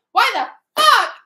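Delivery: loud frustrated yell; slow, loud outburst at the book. Real, not acted. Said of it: "I did just yell".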